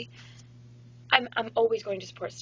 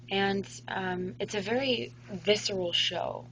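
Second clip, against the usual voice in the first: normal talking voice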